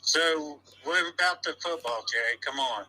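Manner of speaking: lightly voiced gruff caller; a hint of a cranky fan on the phone; fake caller.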